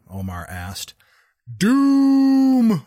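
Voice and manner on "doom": high, loud drawn-out bellow; shouted doom cry. A narrator's reading. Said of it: "the old man shouted".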